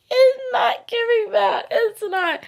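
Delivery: very high, drawn-out giddy squeak; delighted squeal. Real, not acted. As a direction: squeal it slowly, overcome with delight. Delighted after correcting her mental picture.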